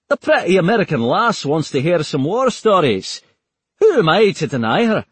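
charming Scottish rogue; Scottish accent. Acted. McLaughlin flirts about Taylor in a loud Scottish accent. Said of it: "in a thick Scottish accent".

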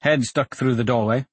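ordinary delivery

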